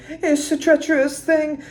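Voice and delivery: high British croon, treacherous stressed; high British singer voice. Shown as a demonstration. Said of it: "kind of very British".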